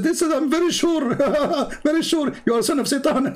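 尖声模仿对方; 高音模仿那人的笃定; mocking caller, very high. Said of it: "He said".

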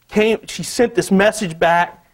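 normal talking voice

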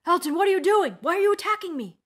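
high, very loud outraged yell; loud, high-pitched indignant protest; cursing in alarm. A narrator's reading. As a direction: cry out in angry disbelief at an attacker. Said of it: "his neighbor cursed".

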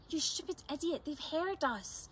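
snapped whisper. A narrator's reading. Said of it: "Stella snapped in a whisper".

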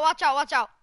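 loud, urgent call; urgent warning. Real, not acted. Warning his teammate that enemies are near, loud and urgent. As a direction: shout the warning fast.

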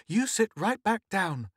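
high, firm mother's order; commanding. A narrator's reading. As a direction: order the child back like a stern mum. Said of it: "commanded Missus Morris".

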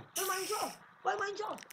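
high-pitched, excited shout of discovery; excited call-out. Real, not acted. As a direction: call out a thrilling find, pitch jumping up. Spots a mineshaft, calls it out. (उत्साह से ऊँची आवाज़ में पुकार)

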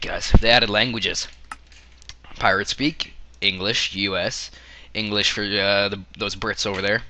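ordinary talk, nothing marked

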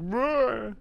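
mock monster roar, rising; playful monster growl. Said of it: "Like him reaching up".

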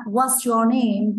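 very slow, clear model question; slow teacher demo. Shown as a demonstration. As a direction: drag each word out for the kids. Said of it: "khi cô giáo hỏi các bạn".

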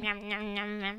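soft nom nom chewing sounds; munch quietly on the block; quiet munching noises. Acted. Eating iron blocks.

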